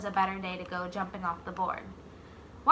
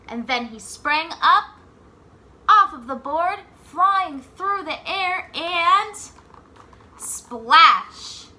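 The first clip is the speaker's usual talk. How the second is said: higher and louder, building to a big splash; building excitement; 6 semitones higher, 10 dB louder, slower than usual. A narrator's reading. Reading the big jump to the class, building to splash. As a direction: narrate the leap with rising thrill.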